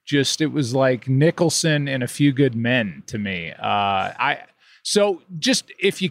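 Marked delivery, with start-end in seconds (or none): none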